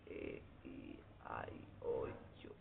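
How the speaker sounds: ए ई आई ओ यू whispered slowly; whispered vowels. Acted. Teacher to class, softly whispered vowel list. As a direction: breathe the vowel list almost silently.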